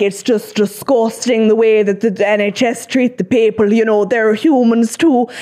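attempted Irish accent, quoting a mother; slightly off Irish lilt. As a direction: put on a dubious Irish accent. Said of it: "that was Irish".

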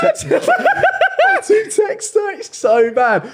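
squeaky, very high, laughing wail, slow; hammed-up lovesick whine, dragging out the words; mock whiny lover. Mocking himself as lovesick.

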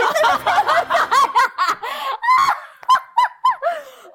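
high squealing burst of laughter; loud shrieking laugh. Real, not acted.

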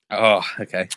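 usual speaking voice

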